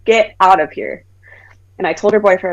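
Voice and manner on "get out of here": firm retold order, a touch of anger; understated angry dismissal. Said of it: "'cause I was angry".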